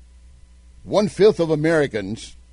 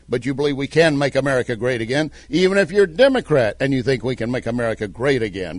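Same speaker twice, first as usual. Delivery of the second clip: ordinary talk, nothing marked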